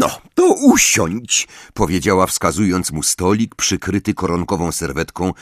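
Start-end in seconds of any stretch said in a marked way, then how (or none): 0.0-1.5 high, slow sugary command; sweet but bossy teacher; Umbridge voice. A narrator's reading.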